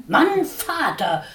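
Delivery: loud, high, slow call; shouting for husband. A narrator's reading. Watscheline shouts for her husband Dickhop. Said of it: "schrie Watscheline".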